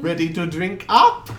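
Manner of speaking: put-on high, loud, muddled European accent; wandering foreign accent. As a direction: play a tickled foreigner with a drifting accent. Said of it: "his accent keeps jumping around a lot".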